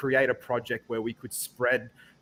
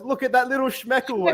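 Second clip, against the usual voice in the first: high fond coo of a new mum; doting mum; 11 semitones higher, 4 dB louder than usual. Retold or imitated. Mum in the film cooing high and fond over her newborn son. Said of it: "his mum looks at him and goes".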